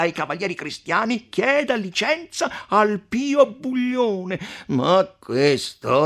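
slow, pressing mayor's outburst; a magistrate shouting over a rival; shouting podestà. A narrator's reading. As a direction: argue it back at full voice. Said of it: "replicava non meno urlando il podestà".

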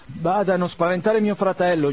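urgent order. A narrator's reading. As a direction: press the servant hard.

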